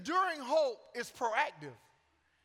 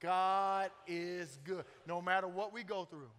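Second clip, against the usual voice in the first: very slow, evenly spaced declaration; slow call to say it together; leading congregation in unison; 2 semitones lower than usual. Real, not acted. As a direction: lead the room word by word. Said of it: "say that with me".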